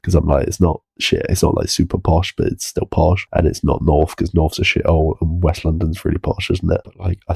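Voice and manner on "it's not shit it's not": normal talking voice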